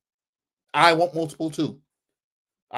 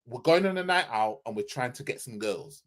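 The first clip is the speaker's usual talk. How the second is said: usual speaking voice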